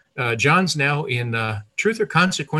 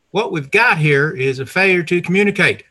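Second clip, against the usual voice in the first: a light imitation of the drawling prison sheriff, a bit louder; quoting the movie sheriff with some swagger; Cool Hand Luke sheriff; 5 semitones higher, 5 dB louder than usual. Storyteller quoting the Cool Hand Luke sheriff, mild mock menace. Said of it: "what the prison sheriff on Cool Hand Luke stated".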